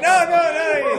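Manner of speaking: very high, loud mock wail; hammed-up tantrum cry; upset toddler imitation. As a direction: shriek it like a panicking small child. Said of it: "He gets upset".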